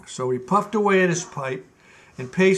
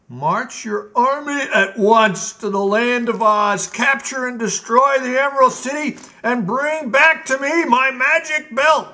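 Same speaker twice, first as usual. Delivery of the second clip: raised imperious decree; commanding Gnome King; 7 semitones higher, 6 dB louder than usual. Acted. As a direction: order the war like a tyrant. King orders the general.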